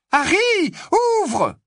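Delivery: put-on worried girl yelling his name; calling out loud. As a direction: shout through a locked door. Hermione pounding on the door.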